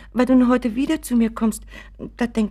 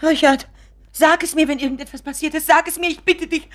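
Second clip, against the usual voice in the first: high, loud desperate begging; dramatic pleading; 8 semitones higher, 4 dB louder than usual. Acted. Mother begs Richard to confess, loud desperate pleading. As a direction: cry out, begging him to tell. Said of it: "schrei doch nicht gleich so dramatisch herum".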